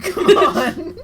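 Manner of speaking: laughing. Real, not acted. Laughing at own joke.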